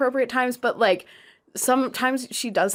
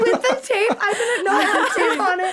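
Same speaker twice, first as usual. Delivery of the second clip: high, giggling astonishment; laughing disbelief; 9 semitones higher than usual. Real, not acted. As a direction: squeal it through real laughter. Amused disbelief at the eaten note.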